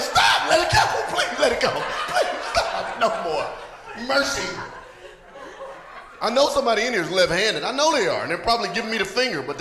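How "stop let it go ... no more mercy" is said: high, strained begging; hammed-up cry for mercy, breaking up at the end; mock pleading. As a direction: beg comically as if in pain. Acts out pained pleading for mercy.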